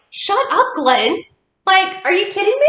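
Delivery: high, playful shut-up to a know-it-all; mock exasperation. Telling Glenn to shut up, mock exasperated. Retold snapping at a stranger.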